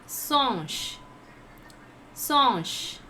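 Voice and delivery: "Singe" said as "sonsh", IN turned into one nasal vowel. Shown as a demonstration. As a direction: demonstrate "Singe" slowly falling, like "sonsh". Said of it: "I N you have to pronounce a".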